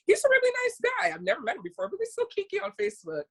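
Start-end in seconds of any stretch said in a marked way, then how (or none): 1.9-3.2 higher, quick, sly tease; teasing aside. Real, not acted. Gossiping to [name] about a friend, high, fast and teasing.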